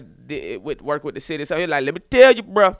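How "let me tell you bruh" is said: higher, louder, urgent buddy voice; hammed-up urgent warning, pitched up; homeboy giving advice. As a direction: imitate an insistent friend laying out a survival plan. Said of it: "His homeboy, I guess".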